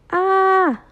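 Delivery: high echo of the yelp, from far off; the mountains answer the cry. Acted. (hoge echo van de kreet)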